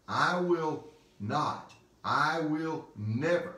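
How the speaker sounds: "not" and "never" slow and weighty; emphatic negatives. Acted. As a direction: hammer "not", then "never", with pauses. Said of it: "two negatives before the word leave".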